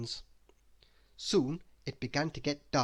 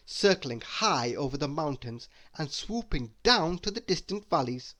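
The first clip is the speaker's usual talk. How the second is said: usual speaking voice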